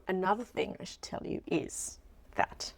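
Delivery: whispered aside, as if sharing a secret; conspiratorial whisper. Acted. Vlogger confiding to her viewers, in a whisper. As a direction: lean in and whisper it.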